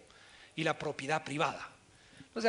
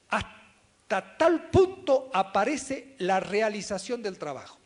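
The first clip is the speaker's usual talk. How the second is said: loud, very slow, spaced-out words; slow reading; 3 semitones higher, 8 dB louder, slower than usual. Shown as a demonstration. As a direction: read it slowly and forcefully, phrase by phrase. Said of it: "Lo voy a leer despacito". (lectura despacito, fuerte y pausada)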